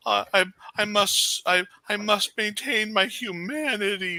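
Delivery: high, whining melodramatic lament; whiny Louis. Retold or imitated. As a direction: whine it out like a brooding vampire. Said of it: "Louis gave us the whiny vampire trope".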